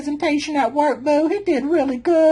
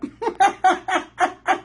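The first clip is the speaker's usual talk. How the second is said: cracking up over the bird joke; real laugh; 3 semitones higher than usual. Real, not acted.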